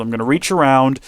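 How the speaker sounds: ordinary delivery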